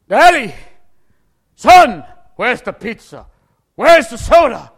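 dad calling his son about the pizza; demanding father. Retold or imitated. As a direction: bellow for the missing dinner.

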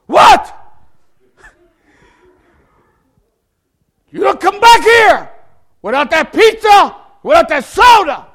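high, loud shouted threat, slowed for weight; father ordering his son not to come back; furious father. Retold or imitated. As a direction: lay down the law at full volume.

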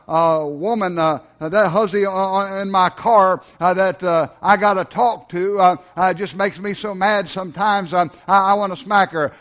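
ordinary talk, nothing marked

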